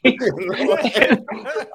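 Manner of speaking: high, helpless laughter bursting out; real laughter. Real, not acted. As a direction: laugh hard and high at the memory. Laughing at the memory.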